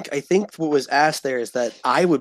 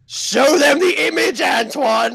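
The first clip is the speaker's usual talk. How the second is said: calling out; 6 semitones higher, 3 dB louder than usual. Real, not acted. Calling to Antoine repeatedly.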